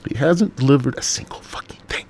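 hushed, voiceless bitter complaint; bitter whisper. Real, not acted. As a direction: whisper it like a grudge. Bitter aside after ranting.

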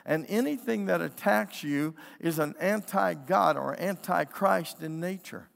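ordinary delivery

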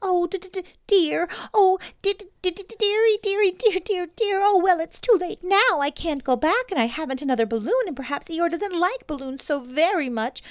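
high, loud stuttering squeak; dismayed Piglet. Acted. Piglet mourning his balloon, high stammering squeak.

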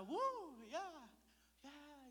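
high-pitched celebratory whoops; excited cheering. Retold or imitated. Cheering after the worship concert, excited high whoops. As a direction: whoop high, fading in volume.

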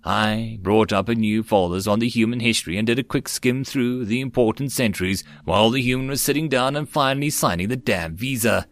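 ordinary delivery

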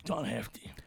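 whispering. Real, not acted. Whispered aside.

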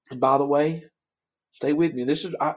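ordinary talk, nothing marked

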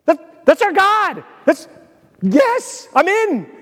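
high, exclaiming fan excitement; acted thrill, pitch jumping up; so excited. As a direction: cry out like a proud, all-in supporter. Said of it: "We are gonna be so excited".

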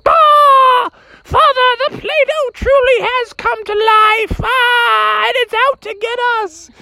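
very high shrieking mother; scream, then call for help in a piercing pitch; panicked mom. Acted. Mom sees python and screams.

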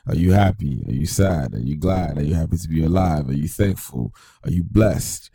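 loud, booming show host opening; announcer intro. Acted. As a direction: open the show like a radio host.

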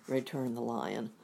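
plain reading voice

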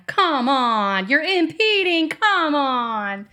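impatient call to the slow driver, rising; vocal impatient driver. Retold or imitated. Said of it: "I was being vocal about it".

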